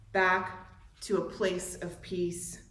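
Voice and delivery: ordinary delivery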